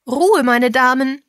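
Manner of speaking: loud, high call for order. A narrator's reading. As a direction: call a chattering room to silence. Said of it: "rief Misses Mason".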